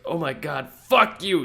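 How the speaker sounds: building to a loud, high shout; mock outraged yell. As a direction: explode on the curse as the furious hero. Emu's outburst before punching.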